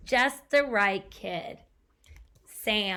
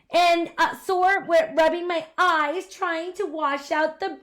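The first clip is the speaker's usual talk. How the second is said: put-on high, loud tearful wail of a small boy; crying child; 7 semitones higher, 8 dB louder than usual. Sam crying about his bad day, tearful. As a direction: pour out every woe through tears. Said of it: "the little boy cried".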